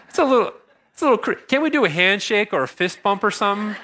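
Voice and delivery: squirming, high-voiced plea for an alternative; awkward reluctance. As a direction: stammer out an uncomfortable objection. Squirming reply to an awkward request.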